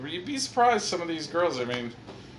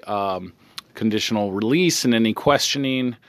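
officious cop reading the interview details into the recording, louder; police officer; 2 semitones lower, 5 dB louder, slower than usual. Acted. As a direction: play the cop stating it all for the record.

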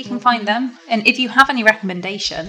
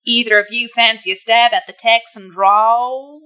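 Texan accent; 6 dB louder than usual. Shown as a demonstration. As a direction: give a big amateur attempt at a Texan accent. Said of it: "but in a Texan accent".

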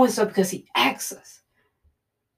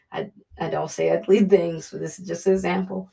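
ordinary talk

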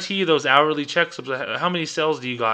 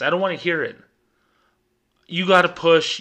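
loud, higher mock scolding; pushy boss; 5 semitones higher, 5 dB louder than usual. Manager pressing banker James to hit his goal, loud mock scolding. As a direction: hammer the banker to sell harder.

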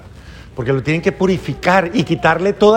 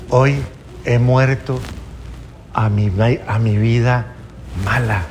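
tender declaration; 8 semitones lower than usual. Acted. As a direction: speak it like a heartfelt prayer. Oración de renacer guiada. (declaración tierna y pausada de amor)

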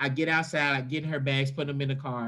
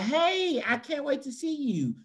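much higher, sing-song airport pickup greeting; mock sweet greeting; 9 semitones higher than usual. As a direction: chirp it like an overeager friend at arrivals. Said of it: "you can't just park and be like".

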